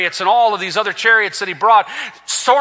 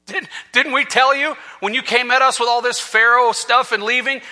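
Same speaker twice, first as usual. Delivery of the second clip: normal talking voice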